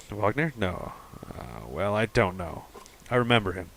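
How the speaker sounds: normal talking voice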